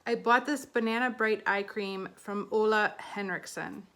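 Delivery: normal talking voice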